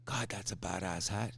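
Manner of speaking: low, quiet mock compliment to a stranger; admiring murmur. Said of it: "and you walk up to him and you go".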